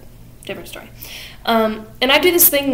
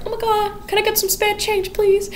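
much higher pleading beggar voice; squeaky beggar woman; 12 semitones higher than usual. Retold or imitated. Narrator voicing a beggar woman asking for change, in a high squeak.

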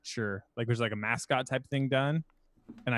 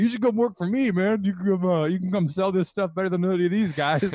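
pitched up, louder pushy boss pitch; eager boss; 7 semitones higher, 5 dB louder than usual. Retold or imitated. Supervisor pitching a job to caller, mock salesy eagerness. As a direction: sell it like a slick sales boss hiring him.

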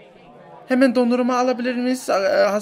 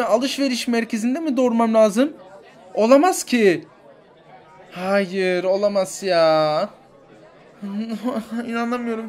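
ordinary delivery

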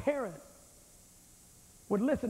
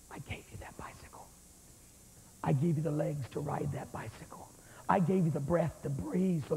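whispered voice of God; 7 semitones lower than usual. Acted. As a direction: breathe it like a secret from above. God's voice, whispered to the sinner.

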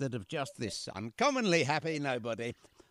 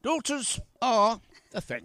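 higher and louder in a put-on voice; hammed-up proclamation; 8 semitones higher, 5 dB louder than usual. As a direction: proclaim it like a pompous orator. Playful echoing game with daughter.

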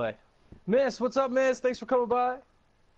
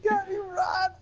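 panicked cry. Real, not acted. Caught by killer in game.

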